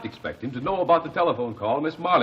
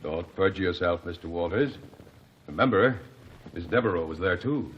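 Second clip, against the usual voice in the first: stern low warning; 5 semitones lower, 4 dB quieter than usual. Acted. Lawyer warns witness sternly.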